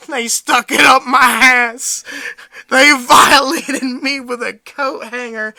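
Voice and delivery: loud, slow mock-crying wail; exaggerated sobbing confession, drawn out; imitated tearful outburst. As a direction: wail it like a hammed-up victim in tears. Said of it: "his eyes are filled with tears".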